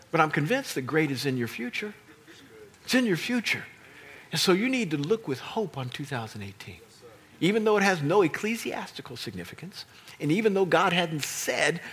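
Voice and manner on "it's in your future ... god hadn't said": normal talking voice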